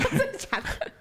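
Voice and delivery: high, slow, laughing disbelief; real laughing surprise. Real, not acted. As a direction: gasp it out laughing. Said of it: "laughing disbelief".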